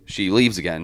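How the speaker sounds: ordinary talk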